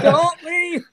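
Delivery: high, loud comic cry; mock desperate plea. Manager begging [name] to stay, hammed-up shouted plea. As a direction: call out after someone walking off. Joking plea as bandmate walks out.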